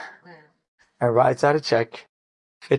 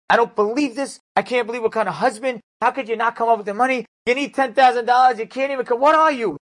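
high, loud exasperated berating; angry wife again; 10 semitones higher, 4 dB louder than usual. Retold or imitated. Wife berates husband again, high angry rant. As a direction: rant at him, high and incredulous. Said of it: "The wife again".

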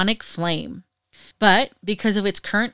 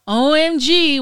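"O M G" lettered out in a mock French accent; 6 semitones higher, 4 dB louder than usual. Shown as a demonstration. Vlogger gushes over the bus restaurant menu, a light mock French accent. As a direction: toss off "O M G" in a playful French accent. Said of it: "with a French accent".

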